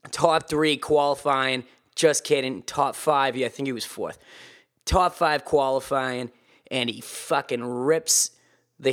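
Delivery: hammed-up Boston accent race report. Podcaster giving a motocross race report as a person from Boston, Boston accent. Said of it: "as a person from Boston".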